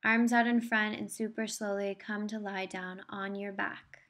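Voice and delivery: ordinary talk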